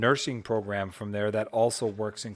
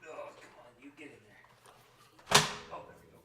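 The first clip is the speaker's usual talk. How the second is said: distant from the microphone; muttering away from the mic; far off mic; 7 dB quieter, slower than usual. Real, not acted. Coaxing device off mic.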